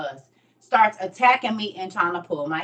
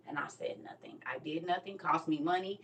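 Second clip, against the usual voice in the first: hushed confession; 14 dB quieter than usual. Real, not acted. Emotional confession, voice drops.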